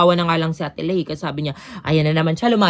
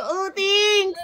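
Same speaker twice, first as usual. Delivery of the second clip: high, giddy mock thanks; flustered thanks; 11 semitones higher than usual. Retelling her reply to the handsome European, high and giddy. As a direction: squeak it out, overwhelmed. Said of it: "Sabi ko".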